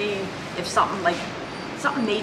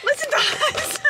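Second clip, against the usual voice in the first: out of breath; 4 dB louder, slower than usual. Real, not acted. Panting after running.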